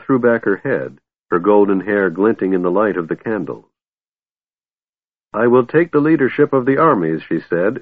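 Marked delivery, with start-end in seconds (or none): 5.3-7.2 cold. A narrator's reading. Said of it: "in a voice nearly as cold".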